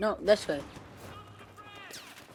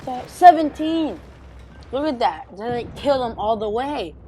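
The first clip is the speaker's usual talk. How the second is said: excited shout; 6 semitones higher, 7 dB louder than usual. Real, not acted.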